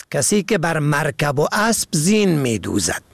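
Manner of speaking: normal talking voice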